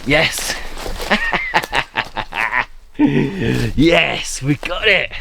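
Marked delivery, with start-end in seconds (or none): 0.6-2.6 real staccato laugh of relief; delighted laugh. Real, not acted. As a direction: laugh in short bursts at the find. Finds the bunker he sought.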